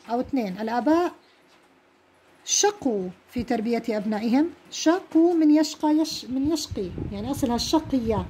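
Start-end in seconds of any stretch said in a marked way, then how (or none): 4.7-6.9 يشقى then يشقي said slowly to contrast the final vowel; a teacher sounding out the root; slow careful enunciation. Shown as a demonstration. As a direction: stretch out شقوا for the class. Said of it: "لأنه أصلها ياء".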